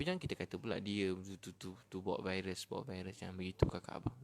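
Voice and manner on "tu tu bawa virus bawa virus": ordinary talk, nothing marked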